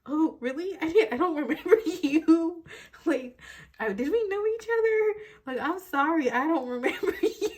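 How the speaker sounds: high-pitched, giggling mock apology; giggly feigned innocence. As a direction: play coy and clueless, barely holding in the giggles. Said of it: "you're kinda all giggly, like".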